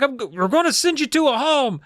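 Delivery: high-pitched mock parental threat; mock threatening mom. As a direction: threaten like a mother at her wits' end. Acted quarrel, Mom threatens Amy.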